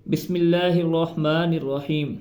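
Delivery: slow, careful Quran recitation; drawn-out measured reading of each word. Shown as a demonstration. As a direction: recite it slowly and clearly. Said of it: "یہاں پر سب سے پہلے".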